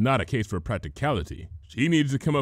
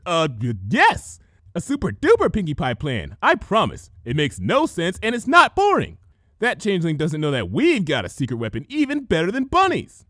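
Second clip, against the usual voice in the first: higher, louder excited promise; eager Pinkie; 6 semitones higher, 5 dB louder than usual. A narrator's reading. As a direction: reassure them with bouncy enthusiasm. Pinkie eagerly defends her plan.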